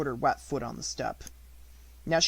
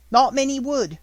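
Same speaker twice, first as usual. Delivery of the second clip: high, loud, kindly baker; a cheerful motherly baker; hearty baker woman; 11 semitones higher, 10 dB louder than usual. A narrator's reading.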